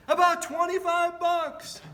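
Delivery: high, loud, slow casual ask; panhandler's voice. Retold or imitated. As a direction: drag it out, high and cheeky. Panhandler naming his price.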